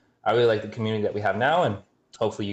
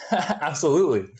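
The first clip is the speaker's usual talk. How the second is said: laughing; 6 semitones higher than usual. Real, not acted. Laughing at bribe offer.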